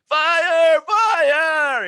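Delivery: very high, loud panicked shriek; George Costanza panic. Retold or imitated. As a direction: shriek like a coward fleeing. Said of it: "I'm like George Costanza at that kid's party".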